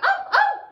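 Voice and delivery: very high, loud, short yelps; peacock yelping. Shown as a demonstration. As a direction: yelp like a peacock. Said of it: "Yelping like a peacock?".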